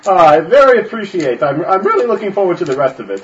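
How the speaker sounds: high, loud pompous gentleman; pompous nobleman. Acted. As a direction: play a fussy upper-class gent, up high. Noble character addresses showman.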